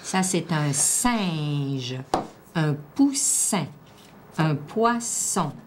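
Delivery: slow, drawn-out single words; careful word dictation. Shown as a demonstration. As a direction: give each word room, stretching it out. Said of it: "penser quel son qu'on cherche".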